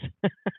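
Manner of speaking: quick high laugh; brief tired chuckle; short laugh. Real, not acted. Tired host laughs at himself.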